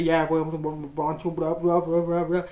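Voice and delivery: low British policeman babble; low, unintelligible imitation of British speech; mock British gibberish. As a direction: garble it in a mock British accent. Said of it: "Je sais même pas ce qu'il disait".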